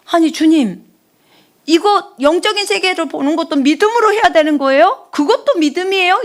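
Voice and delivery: high, loud, amazed questions to God; astonished. Retold or imitated. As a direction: gasp it out in disbelief. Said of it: "그 깜짝 놀라서".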